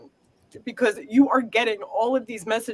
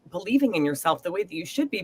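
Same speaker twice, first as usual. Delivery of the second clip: ordinary delivery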